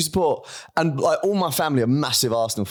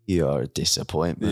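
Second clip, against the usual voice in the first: lower, flat, dry dismissal; stern dad put-down; 8 semitones lower than usual. Retold or imitated. Mate's dad telling him he's a disappointment, low and dry. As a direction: deliver the verdict like a let-down father.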